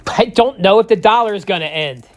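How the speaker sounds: normal talking voice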